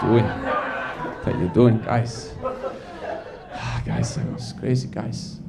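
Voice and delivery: hushed and slow, deflated; mock despairing whisper. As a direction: sigh it out to the crowd.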